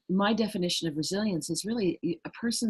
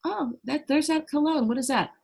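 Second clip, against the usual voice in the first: much higher, jolted pitch, sudden recognition; startled alarm; 8 semitones higher, 4 dB louder than usual. Retold or imitated. Shopper smelling an attacker's cologne, startled and high. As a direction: gasp as the scent hits you. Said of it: "You think you're gonna go".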